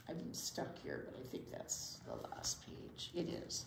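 soft low aside; muttered aside. Real, not acted. Teacher checking the last page under her breath, soft aside. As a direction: mutter to yourself over the page. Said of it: "aside while turning page".

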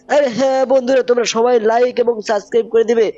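put-on high child voice bossing viewers to subscribe; high cartoon boy telling friends to like and subscribe; cartoon kid nephew. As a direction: play the cheeky nephew threatening playfully.

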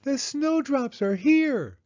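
put-on very high, slow, thrilled child's voice; Penny telling Mama the news, high and thrilled; excited little girl. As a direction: announce it like the best news ever. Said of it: "she said".

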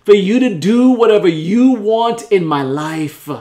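loud, swelling prayer to God; fervent prayer. Acted. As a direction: cry out in faith with stretched key words.

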